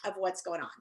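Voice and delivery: normal talking voice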